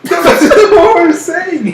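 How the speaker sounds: high, loud, slow words through real laughter; can barely get it out for laughing; squeaky laugh-talk at the friend's gaffe; laughing hard. Real, not acted. Cracks up at friend's mistake.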